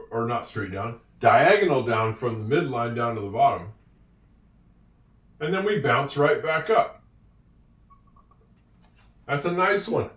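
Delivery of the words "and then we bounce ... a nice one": ordinary talk, nothing marked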